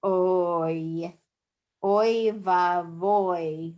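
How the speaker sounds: Oy vavoy stretched slowly, oy leaned on; slow example. Shown as a demonstration. Teacher reading the textbook example to students. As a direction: read the example slowly for the class. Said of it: "they just give an example here".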